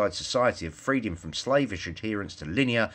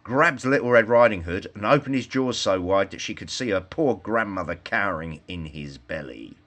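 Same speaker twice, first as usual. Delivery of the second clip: ordinary delivery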